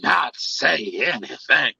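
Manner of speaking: low, slow, scandalised voice; mock-offended coworker telling the story; pearl-clutching woman. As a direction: drop low and drag it out, shocked. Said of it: "clutch her pearls".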